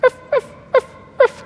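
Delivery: very high squeaky yips; tiny puppy yip. Retold or imitated. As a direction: yap like a handbag puppy. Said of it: "tiny little puppy".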